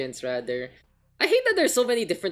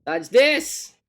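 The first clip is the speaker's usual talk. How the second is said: playful shout; 4 semitones higher, 7 dB louder, much slower than usual. Real, not acted. Calling out teammate to lobby.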